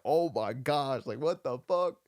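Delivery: stunned, slow gasp of disbelief rising into a loud, high swear; retelling the moment he meets his idol, stunned and loud; shocked. Retold or imitated. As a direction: starstruck shock spilling out. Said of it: "in shock".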